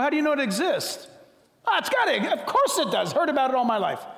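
high, loud rapid-fire questioning; loud, high comic interrogation; skeptic vs traveler. Acted. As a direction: badger the traveler. Acted skeptic dialogue.

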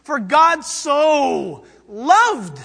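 "so" drawn out slowly and loud; stress on so. Shown as a demonstration. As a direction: linger on "so". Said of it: "reprising the stressed version".

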